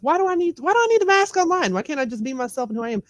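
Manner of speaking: high-pitched pleading question to herself; retold exasperation. Recalling why she quit masking online, exasperated. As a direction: replay the fed-up question, pitch leaping up. Retelling her own fed-up realization.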